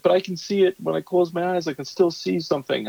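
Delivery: put-on high, worried little-boy insistence; anxious kid voice. Son telling dad he still sees it, high and worried. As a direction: plead like a kid who can't unsee it. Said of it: "And he's still like".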